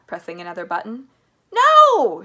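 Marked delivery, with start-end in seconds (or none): 1.5-2.2 high, full-force shout; alarmed shout. A narrator's reading. Charlie sees the roof coming, panicked shout. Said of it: "shouted Charlie".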